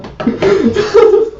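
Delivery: squeaky burst of laughter; high giggle. Real, not acted. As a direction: giggle helplessly. Laughing at the memory.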